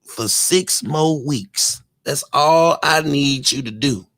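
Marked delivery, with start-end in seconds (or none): 0.1-1.8 very slow, falling and firm; slow emphatic. Acted. Restating the favor to the streamer, very slow and firm. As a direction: lay each word down like terms. Repeating the request firmly.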